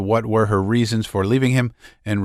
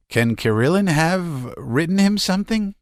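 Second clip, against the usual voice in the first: anxious; 9 semitones higher than usual. Acted. Nadezhda's frightened thought.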